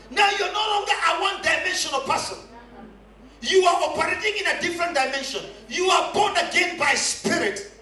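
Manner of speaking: high-pitched, driving preacher; impassioned sermon proclamation; full-force preaching. Real, not acted. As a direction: declare it over the congregation.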